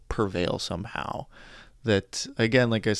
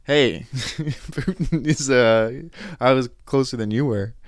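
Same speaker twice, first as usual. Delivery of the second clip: slow, laughing I-told-you-so; imagined taunt to the guy in the locker room, gloating; gloating tease; 3 semitones higher, 4 dB louder, slower than usual. Pretend. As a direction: rub it in with a grin you can hear. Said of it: "I almost wanted to just be like".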